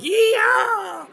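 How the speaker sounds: very high, loud, slow yee-haw; mock cowboy yell. Seth Rollins showing off his gimmick, high slow cowboy whoop. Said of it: "he's gonna be like".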